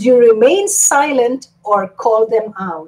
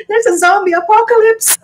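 high, fast fake alarm shout; mock panic; 9 semitones higher, faster than usual. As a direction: announce the apocalypse in fake alarm. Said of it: "And pretend like".

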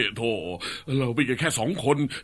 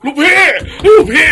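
high, loud panicked call with a laugh; frantic high shouts to the boss; alarmed shout; 11 dB louder than usual. Acted. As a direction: burst in yelling for the boss. Henchman rushes in alarmed.

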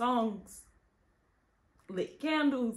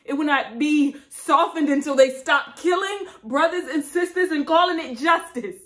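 tragic heroine sorrow; 3 semitones higher, 6 dB louder than usual. Acted. Vow building to a shout.

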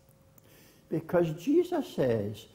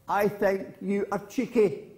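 higher-pitched mild taunt; girl calling to hills; 5 semitones higher than usual. Acted. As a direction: call it out high at the hills. Girl in story calls to hills.

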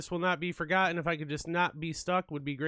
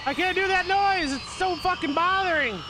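panicked; 10 semitones higher than usual. Real, not acted. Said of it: "I'm so scared".

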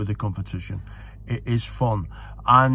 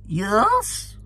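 high-pitched regal impression; Queen Mother; 11 semitones higher than usual. Royal answering a shouting racegoer, imitated in a high voice. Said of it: "so I'll do the voice".

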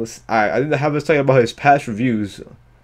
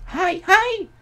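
mock anime voice. Mimicking anime character.

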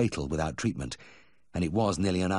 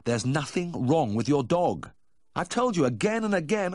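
raised, higher-pitched exasperation; fed-up protest rising higher; exasperated refusal; 10 semitones higher, 4 dB louder than usual. Acted. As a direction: lay down the law, voice climbing. Vet loses patience on phone.

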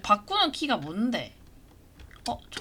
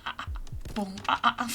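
ordinary talk

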